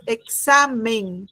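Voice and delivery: "examen" slowed, with the beat on "xa"; stress on SA. Shown as a demonstration. Teacher showing the class the stressed syllable, "examen" slowly with stress on "xa". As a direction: lean on the middle syllable of "examen", drawn out. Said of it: "el golpe de voz".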